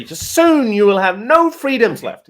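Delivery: slow, high and loud warning; ominous slow announcement; dramatic ominous read. Shown as a demonstration. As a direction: say it like a doom-laden prophecy. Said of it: "Like, just say".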